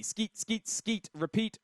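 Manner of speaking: ordinary delivery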